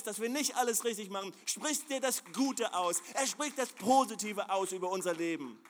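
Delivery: high, loud, driving sermon climb; fervent preacher voice, high and loud; preaching at full force. Acted. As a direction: preach it out to the whole hall, building.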